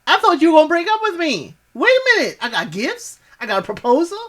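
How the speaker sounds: high, fast giddy disbelief; excited Julie. Retold or imitated. Quoting Julie's giddy shock at the proposal, high and fast. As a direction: squeal it out like she can't believe it. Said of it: "she's like".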